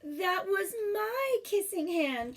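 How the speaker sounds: put-on a child heartbroken over the kiss; crushed little raccoon, near tears; saddest voice. A narrator's reading. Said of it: "in the saddest voice".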